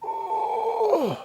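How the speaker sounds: high, breathy mock ooh; cartoon gasp of delight. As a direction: squeal a hammed-up ooh of shock. Mock gasp of the vinyl crowd.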